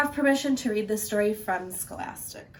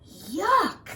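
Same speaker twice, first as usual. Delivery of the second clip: disgusted yuck; 9 semitones higher than usual. Acted. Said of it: "thought the wolf".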